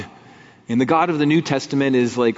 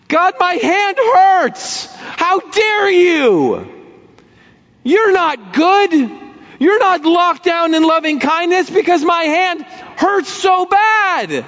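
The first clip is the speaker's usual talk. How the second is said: much higher, slow, indignant complaint at God; whiny accusation; 4 dB louder than usual. Acted. Burned believer blaming God, whiny indignant complaint. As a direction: sulk and accuse like a hurt child.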